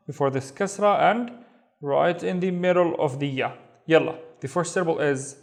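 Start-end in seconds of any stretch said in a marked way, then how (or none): none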